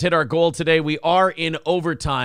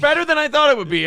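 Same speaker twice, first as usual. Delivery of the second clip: high, fast mocking echo of his words; mocking repeat; 8 semitones higher, 4 dB louder, faster than usual. Mocking his co-host's verdict, high and fast. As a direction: parrot him back, incredulous.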